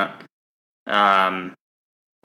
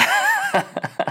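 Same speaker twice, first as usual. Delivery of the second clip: laugh. Real, not acted. Laughing at own mistake.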